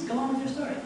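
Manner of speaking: ordinary delivery, nothing marked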